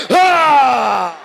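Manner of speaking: high, loud put-on scream; mock scream. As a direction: let out a drugged man's yell. Acting out a drugged man.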